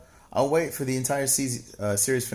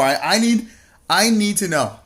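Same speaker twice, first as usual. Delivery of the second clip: higher, louder mock-desperate plea; 7 semitones higher, 5 dB louder than usual. Teasing chatter Mark Rowe for spoilers, mock desperate. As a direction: plead to know, hammed up. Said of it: "mock desperate plea".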